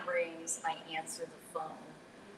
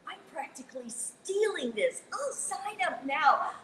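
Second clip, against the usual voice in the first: mock excitement; 9 semitones higher, 6 dB louder, slower than usual. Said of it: "And I thought".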